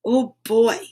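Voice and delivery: plain reading voice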